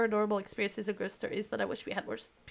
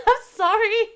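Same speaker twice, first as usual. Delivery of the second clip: laughing apology; 11 semitones higher, 11 dB louder than usual. Real, not acted. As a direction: apologize through a high, loud laugh. Laughing at own remark about sleepers.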